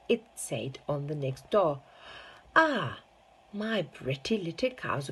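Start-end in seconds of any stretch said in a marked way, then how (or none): 2.6-5.1 high, fond exclamation over his cows; delighted cry. A narrator's reading. Mr Wonka fondly crying over his cows. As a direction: gush with delight. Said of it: "cried Mister Wonka".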